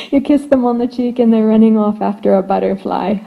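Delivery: normal talking voice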